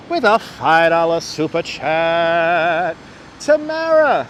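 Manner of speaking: drawn-out announcer call with stretched words; super chat shout-out. Real, not acted. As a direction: hype the donor, stretching it out slowly. Hyping a viewer's super chat donation.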